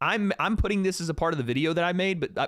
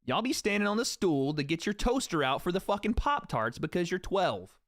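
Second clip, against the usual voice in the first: ordinary talk, nothing marked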